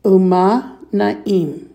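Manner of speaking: "Umma na'im" drawn out, a gap between words; slow, careful language-teacher demo; slow model pronunciation. Shown as a demonstration. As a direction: say "Umma na'im" slowly for repeating. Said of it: "Please repeat".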